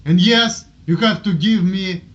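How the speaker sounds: ordinary talk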